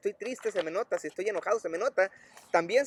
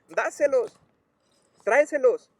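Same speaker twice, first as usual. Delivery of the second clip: high, raised call with an order; mock yell, pitched up; imitated yelling; 5 semitones higher, 4 dB louder than usual. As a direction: imitate calling instructions across the yard. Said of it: "le grita y le dice".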